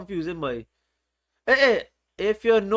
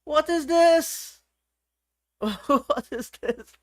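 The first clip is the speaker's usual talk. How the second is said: drawn-out high disbelieving question; mock disbelief; 6 semitones higher, much slower than usual. Teacher pretends disbelief at chat's answer, slow and high. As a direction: act stunned that bad means good. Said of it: "Bad means good?".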